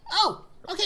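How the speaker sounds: put-on high eager kid voice; bright little delivery boy; young elf kid. Plays young elf Dipley.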